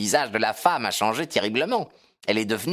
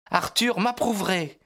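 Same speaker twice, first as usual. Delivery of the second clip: higher, firm daughter's reply; 8 semitones higher than usual. A narrator's reading. Daughter standing up to her mother, in a firm tone. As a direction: answer firmly in a raised pitch. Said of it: "répondit la jeune fille d'un ton ferme".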